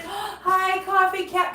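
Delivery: high, delighted greeting; excited gasp hello. Real, not acted. Owner greeting her cat with a delighted gasp.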